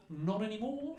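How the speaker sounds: high Australian singsong, rising; smug, carefree Australian punchline; Australian accent. Retold or imitated. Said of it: "he just went".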